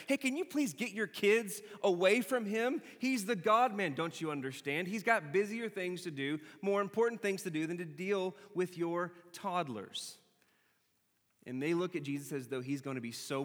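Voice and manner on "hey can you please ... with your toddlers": high, loud mock bodyguard scolding parents; bossy disciple. Disciple shooing parents from Jesus, high and loud mock scold. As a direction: shoo the families away like a self-important bodyguard. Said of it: "It's like".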